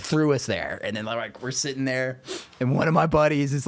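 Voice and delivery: usual speaking voice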